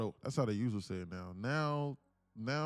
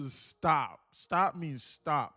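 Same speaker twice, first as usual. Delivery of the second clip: loud, slow, insistent stop; drawn-out loud command; emphatic stop; 5 semitones higher, 9 dB louder, much slower than usual. Acted. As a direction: lay down the law, slowly.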